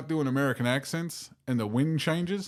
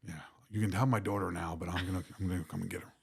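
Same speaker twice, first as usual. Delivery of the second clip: low Northern Irish film tough guy; Liam Neeson; 5 semitones lower than usual. Shown as a demonstration. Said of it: "I can do Liam Neeson".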